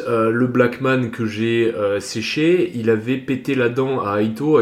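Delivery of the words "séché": normal talking voice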